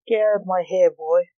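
usual speaking voice

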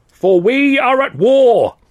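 high, slow, rousing battle cry; orator's cry. Acted. Union orator rousing dockers from the dais, crying out. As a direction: proclaim it to the whole crowd. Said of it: "the chap cried".

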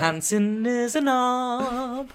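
high, dragged-out teasing jeer; slow mock jeer. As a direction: stretch it out high and mocking. Mock-slow jeering line.

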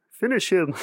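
high frog puppet voice; Kermit the Frog. Retold or imitated. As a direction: croak it like Kermit. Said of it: "What does he go like?".

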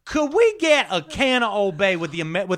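put-on a big, high, gushing Southern belle accent; Southern lady. Spy chatting up Baltimore locals in a loud, high Southern belle accent. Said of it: "She's pretending to be a Southern lady".

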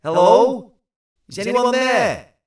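high, loud call into a cave. Acted. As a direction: shout into the cave. Calls into cave at length.

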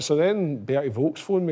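ordinary talk, nothing marked

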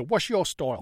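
mangled New Zealand accent imitating dubbed bully; quick mock New Zealand accent; bad New Zealand accent. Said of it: "sounds nothing like a New Zealand".

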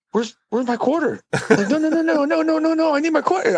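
very high, frantic mock wail; hammed-up high panic over a quarter. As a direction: squeal it like the quarter's gone forever. Quarter lost in payphone.

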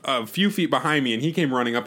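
usual speaking voice

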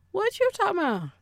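high, put-on questioning voice; high-pitched challenge; different inflection. Shown as a demonstration. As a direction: start way up high, fall at the end. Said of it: "it's all in the way that you say it".

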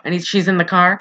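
ordinary delivery

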